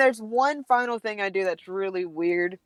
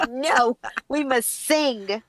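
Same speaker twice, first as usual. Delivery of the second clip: slow, higher, grandly defiant; mock-solemn refusal; 5 semitones higher, much slower than usual. Wife refusing husband's plea to stop howling, mock-grand. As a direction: declare it like a sacred duty.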